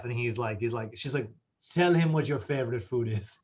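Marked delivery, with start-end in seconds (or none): 1.7-3.4 hammed-up French accent, quoting a dinner guest; terrible French accent. Woman teasing her French chef husband at dinner, terrible French accent. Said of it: "I have a terrible French accent".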